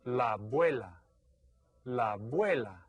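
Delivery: la abuela, la blended into abuela; Spanish word. Shown as a demonstration. Teacher models a Spanish word for kids, clear pronunciation. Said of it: "In Spanish, we call her".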